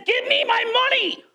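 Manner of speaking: hammed-up debt collector squeezing his debtor; demanding. Parable servant choking the debtor, mock-angry demand.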